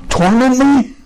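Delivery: high fearful cry of Torment me; a frightened spirit begging; pleading demon. Shown as a demonstration. Demon begs Jesus not to torment, high fearful cry. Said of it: "do you read it".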